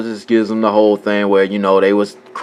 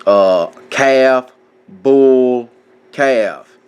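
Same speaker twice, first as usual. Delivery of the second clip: normal talking voice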